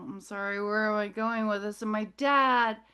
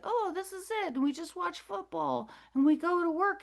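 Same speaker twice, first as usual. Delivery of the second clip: mocking high-pitched everyman; mock normal people; 7 semitones higher than usual. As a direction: imitate oblivious normies, pitched up and light. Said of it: "normal people think".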